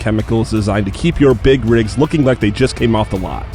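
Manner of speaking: loud, punchy show-opener read; podcast intro announcer. Acted. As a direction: announce it big over the intro music.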